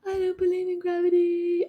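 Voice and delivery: high, loud, sustained wail on one pitch; mock scream. A gravity denier falling to the ground, mock scream. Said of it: "You can scream".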